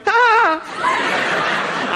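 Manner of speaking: high-pitched reenacted shriek of alarm; startled scream. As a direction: yelp as if startled by someone dreaded. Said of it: "I think I visibly was like".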